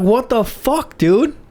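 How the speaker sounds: high-pitched, incredulous protest; indignant disbelief. Retold or imitated. Tased runner looks up at the cop, indignant. Confronts man who tased him.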